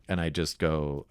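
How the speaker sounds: ordinary talk, nothing marked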